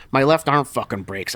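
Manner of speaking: normal talking voice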